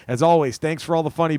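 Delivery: ordinary talk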